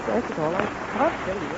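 very soft, slow, spent voice; weakly giving up. Acted. Exhausted patient breaking off his story to his friend, weakly. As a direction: trail off weakly, barely audible.

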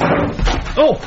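high startled gasp, then a slow alarmed question; startled yelp as an intruder seizes him. Acted. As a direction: caught off guard by a stranger grabbing you. Grabbed suddenly by intruder.

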